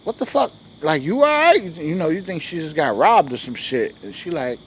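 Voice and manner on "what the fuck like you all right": shocked. Retold or imitated. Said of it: "and you like".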